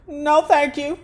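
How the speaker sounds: put-on a touch of [name] accent, politely turning it down. [name] politely declining crack in her accent. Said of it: "in her slippery [name] accent".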